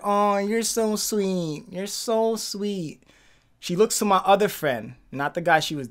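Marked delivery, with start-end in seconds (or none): 0.0-3.0 put-on slow, soft sweet-talk as the girl; girl's sweet voice. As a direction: drawl it out sweetly, lightly imitating her. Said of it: "She was like".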